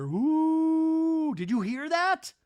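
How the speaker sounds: high, loud imitation of alarm; hammed-up jumpy fright; mock spooked. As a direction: wail it up high like a spooked camper. Imitates scared women hearing a noise.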